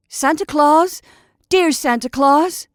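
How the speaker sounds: loud desperate cry into the dark; crying out with a sob. Acted. Scarecrow calls after Santa's passing sleigh, loud sobbing cry. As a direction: call out to Santa, half sobbing. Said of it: "cried Jimmy Scarecrow with a great sob".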